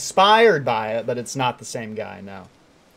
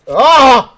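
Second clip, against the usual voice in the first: sudden loud high scream; startled scream; 11 semitones higher, 14 dB louder than usual. Real, not acted. Streamer screaming as the climber falls. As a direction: scream as you fall. Falling in the game.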